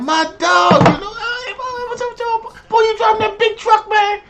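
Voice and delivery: high, bright-eyed hype greeting; over-the-top happy hello to a friend; friend's excited greeting. Retold or imitated. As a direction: greet your old buddy way up high. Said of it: "He was like".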